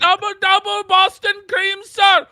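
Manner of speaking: high, shouted caricature order-taker voice; fluttering cries for help. Pretend. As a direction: rattle off orders in a high hammed-up voice. Caricature Tim Hortons worker voice.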